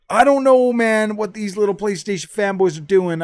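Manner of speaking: a sudden loud, mocking rant at fanboys; exasperated outburst. Real, not acted. Ranting at PlayStation fanboys.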